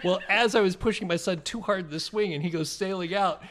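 ordinary talk, nothing marked

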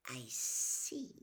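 low whispered cold reply; soft breathed menace. A narrator's reading. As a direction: breathe it out low, barely voiced. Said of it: "breathed the second voice softly".

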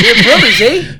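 mock Canadian accent with a lifted "eh". Co-host teasing the Canadian sister station, mock Canadian accent. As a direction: put on a playful Canadian accent. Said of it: "That's a good Canadian accent there".

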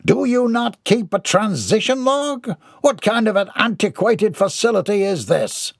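pompous, high-pitched complaint; indignant Mortimer. A narrator's reading. Mortimer berates the postman over his facility, indignant. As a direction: demand answers in grand outrage.

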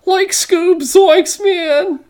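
very high, stoner cartoon drawl; Shaggy voice. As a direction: channel Shaggy from Scooby-Doo. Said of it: "in a Shaggy style".

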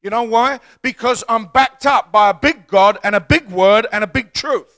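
loud, driving preacher voice; preaching. Acted. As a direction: preach it with conviction. Preaching at full force.

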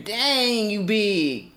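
ordinary talk

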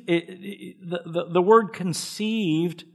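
usual speaking voice

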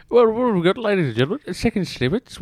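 announcer. Acted. Host announcing the segment.

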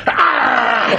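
put-on high sudden childish yelp; imitated kid's shriek. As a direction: mimic a boy jumping out at his mom with a shout. Boy startles mother with toy.

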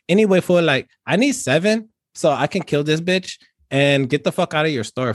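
put-on laid-back Chicano street accent, every line capped with fool.

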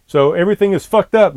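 exasperated burst at the failed livestream; real frustration. Real, not acted. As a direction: vent the anger about the broken stream. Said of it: "but I'm pissed off".

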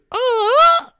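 very high loud mock wail; anguished wail. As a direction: wail in fake despair. End of self-mocking rant.

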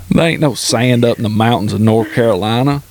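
ordinary talk, nothing marked

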